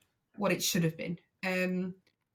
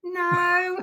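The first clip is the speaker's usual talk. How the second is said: stunned disbelief; 9 semitones higher, 4 dB louder than usual. Retold or imitated. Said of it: "And I went".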